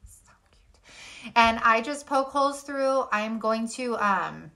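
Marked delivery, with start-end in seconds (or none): 0.1-1.3 whispered. Real, not acted. Admiring the finished sign.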